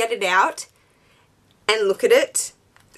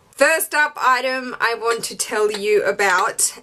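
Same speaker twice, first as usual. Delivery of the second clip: usual speaking voice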